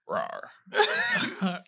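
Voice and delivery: playful high growl; dinosaur voice. Retold or imitated. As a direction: growl like a pouncing beast. Growling like predator in story.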